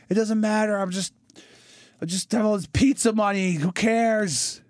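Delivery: put-on high, slow drunken gloating; drunk pizza king. Pizza king throwing pizza at Australians, high drunken gloating. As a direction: sneer at the crowd while tipsy.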